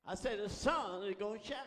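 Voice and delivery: loud, insistent preaching repeat; emphatic repeat. Real, not acted. Preacher promising congregation the sun will shine, loud and emphatic. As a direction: drive the promise home with force.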